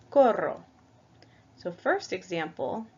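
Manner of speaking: ordinary talk, nothing marked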